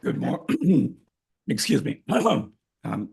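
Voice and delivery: hoarse voice from a sore throat. Real, not acted. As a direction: speak through a sore throat. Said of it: "I have a bit of sore throat".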